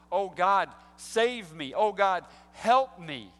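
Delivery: loud, desperate cry to God; imagined Cain crying out to God for help; pleading cry. Pretend. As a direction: beg God for rescue out loud. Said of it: "looked inside his own heart and said".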